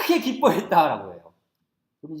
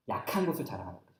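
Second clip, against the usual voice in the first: usual speaking voice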